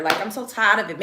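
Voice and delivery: normal talking voice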